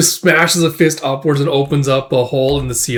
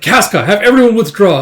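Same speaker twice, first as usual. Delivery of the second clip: raised, loud command; urgent order; 8 semitones higher, 6 dB louder than usual. Acted. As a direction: act a commander calling a retreat.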